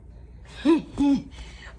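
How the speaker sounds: breathy villain cackle; evil cackle. Acted. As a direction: gloat with a wicked laugh. Gloats I'm so evil.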